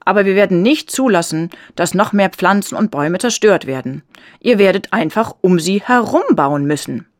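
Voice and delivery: loud, insistent stand against the workers; firm protest. A narrator's reading. Young man confronts construction workers.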